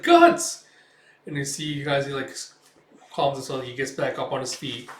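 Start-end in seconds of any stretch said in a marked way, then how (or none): 0.0-0.6 very high, loud cry of a name; alarmed shout. Retold or imitated. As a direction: scream to a friend in danger. Puck cries out as Guts falters.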